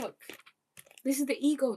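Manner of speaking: ordinary delivery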